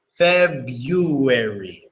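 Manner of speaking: February as fɛbjuɛɹi, the first r dropped into a y sound, slowly; everyday February. Shown as a demonstration. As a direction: say February like Febyuary, drawn out. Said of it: "but we say February".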